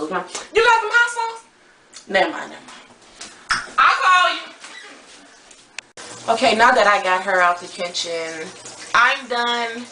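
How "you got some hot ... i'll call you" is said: high, loud sassy cousin. Acted. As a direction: play a brash cousin from the Bay.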